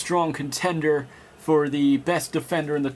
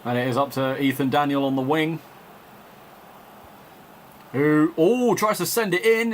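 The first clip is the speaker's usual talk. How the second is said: live play-by-play, caught up in the match; measured broadcast commentator voice; football commentary. Acted. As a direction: call the game like a TV commentator.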